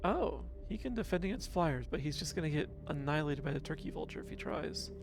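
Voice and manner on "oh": normal talking voice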